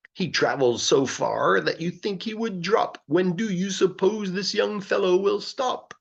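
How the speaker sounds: high, wondering townsperson voice; amazed onlookers. Acted. People who see the bips wondering aloud, amazed.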